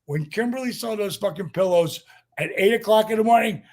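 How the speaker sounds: ordinary talk, nothing marked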